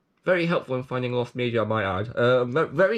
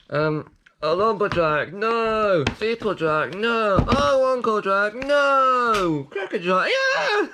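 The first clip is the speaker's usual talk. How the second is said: building excited guesses; 8 semitones higher, 3 dB louder, slower than usual. Real, not acted. Guessing names, rising to laughter.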